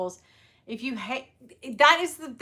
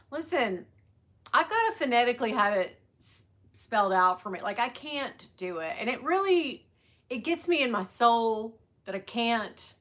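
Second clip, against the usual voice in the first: ordinary talk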